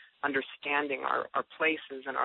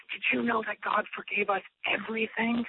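high, eager new believer; much higher, marvelling at good news; wide-eyed wonder; 8 semitones higher than usual. Retold or imitated. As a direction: ask it like a thrilled new convert.

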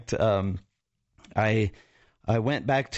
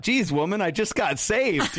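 mock exasperation; 10 semitones higher than usual. His exasperated thought, retold.

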